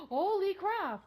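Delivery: gasp, then a loud, slow holy crap; startled out of your seat; stunned loud exclamation; shocked gasp. Real, not acted. Gasps at sudden sight.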